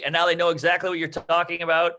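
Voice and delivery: ordinary talk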